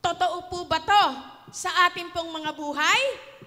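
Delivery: loud, high preaching question; rally the listeners; rousing call. Real, not acted. (malakas na tanong sa kongregasyon)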